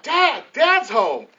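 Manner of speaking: imitated children's cry, starting high and loud; excited kids screaming. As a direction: call out like thrilled kids greeting dad. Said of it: "they start screaming out".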